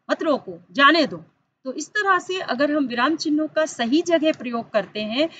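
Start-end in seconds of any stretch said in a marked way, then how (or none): 0.1-1.2 "मत रोको", break, then louder "जाने दो"; group मत रोको, then land firmly on जाने दो; pause after रोको in मत रोको. Shown as a demonstration. Teacher rewords the changed meaning for pupils, pause after मत रोको. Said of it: "यहाँ पर फिर अर्थ बदल गया".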